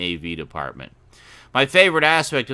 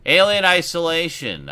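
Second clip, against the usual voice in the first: lightly voiced hipster, higher pitch; 8 semitones higher, 5 dB louder than usual. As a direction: announce the title like a hipster. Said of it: "your hipster voice".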